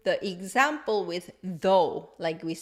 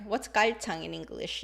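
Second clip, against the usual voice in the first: ordinary talk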